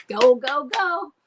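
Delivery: loud, eager go go go; cheering on daughter. Retold or imitated. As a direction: cheer her on like a proud parent. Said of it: "I'm like".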